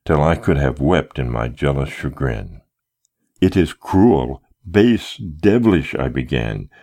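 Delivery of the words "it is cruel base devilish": slow, higher, spitting each word; indignant outburst. A narrator's reading. Trapped suitor denouncing his smiling captor, slow and indignant. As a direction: lay the insults out one by one.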